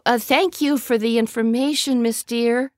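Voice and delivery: calling out. A narrator's reading. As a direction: call out politely after her. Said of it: "called out after her".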